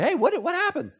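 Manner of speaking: high, alarmed dad asking what happened; a dad spotting a scratch, high and startled; mock alarmed dad. Father questioning his son about the truck, alarmed.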